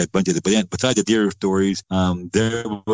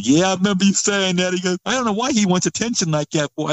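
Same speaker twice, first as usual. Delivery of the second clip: scoffing; 9 semitones higher than usual. Retold or imitated. Dad dismissing him, retold.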